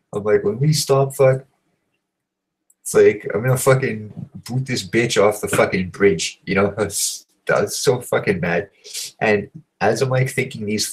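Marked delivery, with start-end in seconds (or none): 0.4-6.4 mildly heated grumbling threat; worked-up, exasperated rant; retold anger. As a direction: vent like you're retelling your own fuming. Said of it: "I'm getting so mad".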